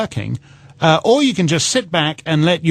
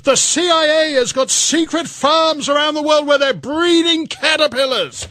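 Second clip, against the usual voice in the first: a much higher, hammed-up panicky voice; mock hysterical protester; 10 semitones higher than usual. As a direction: parody the outraged critics in a squeaky, shocked voice. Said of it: "And everyone's like".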